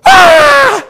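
loud, high-pitched comic scream; acted shriek of sudden terror; frightened scream. Preacher acting Boaz waking at midnight, screaming in fright. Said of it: "Bible says he was afraid".

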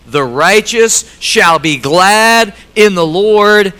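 slow, loud, measured scripture reading; slow unison recitation. Shown as a demonstration. Pastor reciting the verse aloud with congregation, slow and loud. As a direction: lead the church through the verse slowly. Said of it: "Let's say it together".

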